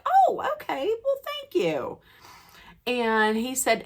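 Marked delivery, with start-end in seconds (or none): 0.1-2.0 high, giddy thank you. Retold or imitated. Fan thanking the star, barely containing herself. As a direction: gush with delight. Fan thrilled at his offer.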